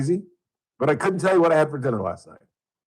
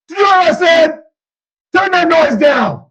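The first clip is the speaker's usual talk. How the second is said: shouting father, much higher and louder; father's yell; 9 dB louder than usual. Retold or imitated. Father yelling at his son to turn the music down. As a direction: bellow at the kid to turn it down.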